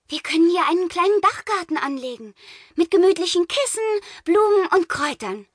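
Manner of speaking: put-on high, bright young girl; eager suggestion. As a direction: pitch the idea to him happily. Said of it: "schlug sie vor".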